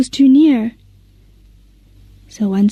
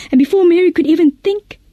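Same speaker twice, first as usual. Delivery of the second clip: ordinary delivery, nothing marked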